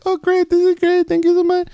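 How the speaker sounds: high, sugary fake-pleased lady; hammed-up overly sweet thanks; mock sweet client. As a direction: gush like a too-happy customer. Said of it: "And she's like".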